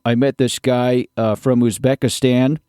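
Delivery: ordinary delivery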